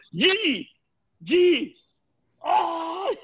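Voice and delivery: high, loud groans of stomach pain; mock pained yell. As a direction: imitate a man crying out on the toilet. Jeter yelling in toilet pain.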